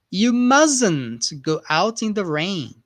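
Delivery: "mustn't" said as musn't, slow and clear; slow careful model with "mustn't" said musn't; mustn't with silent t. Shown as a demonstration. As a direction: drop the t in "mustn't". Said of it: "pronunciation mustn't".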